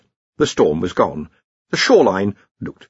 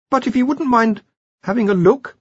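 much higher-pitched explainer; high-voiced academic correcting his seniors; Ponder, earnest junior wizard; 4 dB louder than usual. Ponder coaxing Rincewind.

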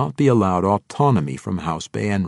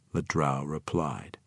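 ordinary delivery

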